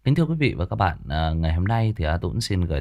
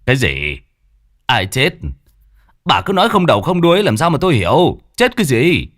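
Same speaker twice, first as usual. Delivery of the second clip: much higher, louder, fast impatient demand; irritated old man; 7 semitones higher, 8 dB louder than usual. Acted. Chief Long demanding what happened from the woman, higher impatient shout. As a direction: snap at her to make sense.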